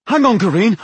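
loud, high pleading shout; urgent shout. Acted. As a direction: shout to stop him. Dwarf pleads with attacking wizard.

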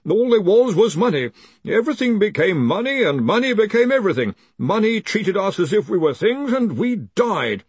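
put-on high, halting, anxious young wizard; timid underling reciting a grim message; frightened reader. A narrator's reading.

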